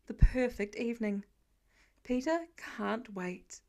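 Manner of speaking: ordinary delivery, nothing marked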